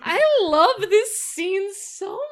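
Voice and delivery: high, loud, drawn-out gushing. Real, not acted. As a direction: gush slowly and loudly, overwhelmed with love for it. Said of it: "[name], are you okay?".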